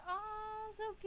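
high, cooing gush. Retold or imitated. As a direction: coo like a fan over a dog. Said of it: "Everyone was like".

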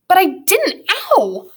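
put-on high-pitched boy's protest, yelping at a cuff; boy's hurt protest. Thomas to Grumpkin as he is cuffed, hurt protest. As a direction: protest cut off by an ow.

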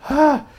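real sudden high shriek; a loud jump-scare yelp; startled scream. Real, not acted. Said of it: "That scared the shit out of me".

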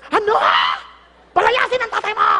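loud, high furious shout; outraged mother. Acted. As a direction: explode in hammed-up anger at the news. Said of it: "ang reaksyon". (kunwaring pasigaw na galit ng nanay)

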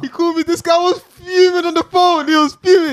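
loud, excited retelling. Real, not acted. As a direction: tell it loud and worked up.

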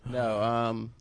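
ordinary talk, nothing marked

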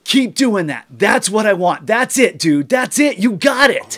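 high loud hyped-up cheering; excited shouting. Retold or imitated. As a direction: cheer him on at full volume. Excited guy shouting encouragement.

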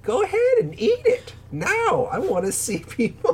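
very high, pushy sweet granny; old lady voice. Retold or imitated. Imitating Rosie urging Robbie to eat, very high granny voice. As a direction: urge him on like an eager little old lady.

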